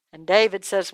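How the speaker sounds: usual speaking voice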